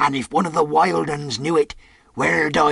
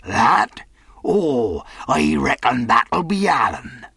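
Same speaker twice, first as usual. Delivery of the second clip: wary, smiling; 3 semitones higher, 4 dB louder, slower than usual. Acted. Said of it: "smiling away, but warier than ever".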